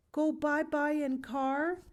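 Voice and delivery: put-on high, slow baby talk; small girl pleading to go in the car; toddler voice. A narrator's reading. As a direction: ask like a little child begging for a ride. Toddler voice in story.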